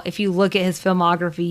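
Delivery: usual speaking voice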